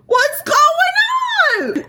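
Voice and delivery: high, drawn-out squeal of shock; startled mock shriek. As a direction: squeal it out in exaggerated surprise. Acts out her startled reaction.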